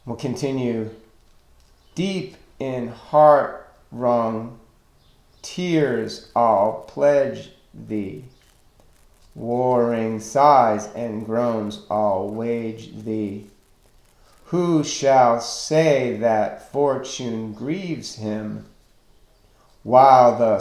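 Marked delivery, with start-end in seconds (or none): 2.0-8.2 very slow, drawn-out verse; scanning the meter. Shown as a demonstration. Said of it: "you can see that the stressed syllables". 9.3-13.5 slow, drawn-out verse reading; scanning the meter. Shown as a demonstration. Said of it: "you can see that the stressed syllables". 14.4-18.7 slow, measured verse reading; scanning the meter. Shown as a demonstration. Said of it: "you can see that the stressed syllables".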